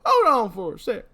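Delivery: put-on high, creaky old-timer voice; old prospector. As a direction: play a cranky old codger. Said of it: "It just really works for this voice".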